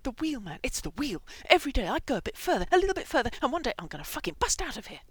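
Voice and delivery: high, loud, fast rant; manic excitement. A narrator's reading. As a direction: build into a frantic escape pitch. Manic hamster explains his escape plan.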